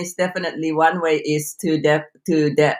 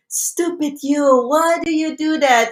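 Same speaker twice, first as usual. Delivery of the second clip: higher, exasperated self-scolding; self-berating voice; 8 semitones higher than usual. Retold or imitated. Inner critic berating yourself after a mistake, higher. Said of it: "You make a mistake and you say".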